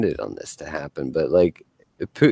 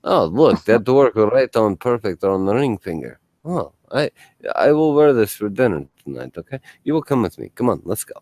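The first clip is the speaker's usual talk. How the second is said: put-on Russian accent for a retold leader. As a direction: play the Russian boss, accent and all. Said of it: "I've got to do a Russian accent".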